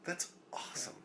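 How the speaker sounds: whispered. Shown as a demonstration. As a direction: whisper it to yourself. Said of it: "And that's awesome".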